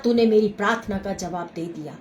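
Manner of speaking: whispered thanksgiving; fading thanks. Real, not acted. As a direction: let the thanks fade to a whisper. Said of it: "fading thanksgiving prayer".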